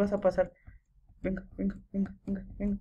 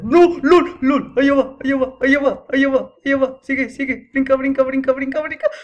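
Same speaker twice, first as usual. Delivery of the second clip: loud, higher rapid-fire yelling; panicked shouting; 5 semitones higher, 12 dB louder than usual. Real, not acted. Player urging the character to jump, panicked shouting. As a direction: shout frantic repeated commands at the game.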